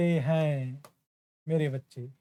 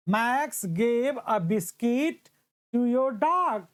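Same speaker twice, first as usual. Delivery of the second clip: very slow, each word spaced out; slow dictation; 4 semitones higher, much slower than usual. Shown as a demonstration. Teacher reading an example slowly to students, dictation pace.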